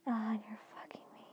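usual speaking voice

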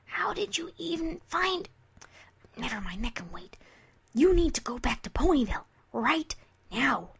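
urgent hushed whisper. Acted. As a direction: whisper it, scared to be heard. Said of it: "Scootaloo whispered".

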